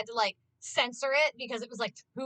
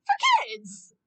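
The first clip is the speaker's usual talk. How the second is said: mock-indignant falsetto jab; a sarcastic high squeal at the rating; sarcastic squeak; 5 dB louder than usual. Real, not acted.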